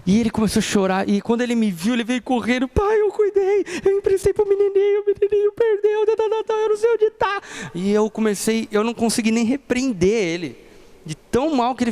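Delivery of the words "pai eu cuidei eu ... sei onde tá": put-on high, tearful little boy's voice; crying child. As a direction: plead like a boy who lost his toy. Crying son explains lost toy.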